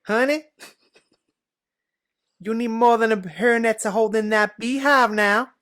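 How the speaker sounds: bad Southern American sass, pushed loud and slow; sassy Southern American. Friend dares him to do Southern American accent, loud sassy try.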